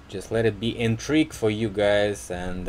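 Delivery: usual speaking voice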